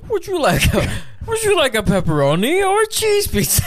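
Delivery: very high, loud squeaky voice; shrieking like a helium balloon. Pretend. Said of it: "Do one more. Talk about pizza".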